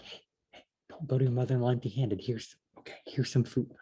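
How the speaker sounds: furtive, very soft mock whisper; hushed imitation. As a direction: keep it down as if nobody must hear. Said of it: "How quiet is it to scoop out some barley".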